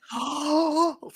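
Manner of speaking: sharp high gasp; surprised gasp. Real, not acted. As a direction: gasp in disbelief. Shocked reaction.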